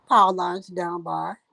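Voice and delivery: ordinary delivery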